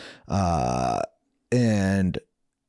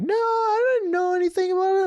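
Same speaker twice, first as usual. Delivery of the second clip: mom's denial. Retold or imitated. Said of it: "My mom's like".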